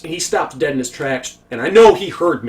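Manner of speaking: ordinary talk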